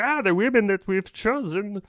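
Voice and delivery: put-on quoting the film's doctor in a strained, failed accent; Doctor Strangelove impression. Reviewer attempting Strangelove's accent while recounting the film, then giving up. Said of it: "I can't do his accent".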